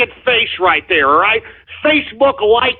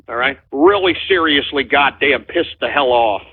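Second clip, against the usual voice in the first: normal talking voice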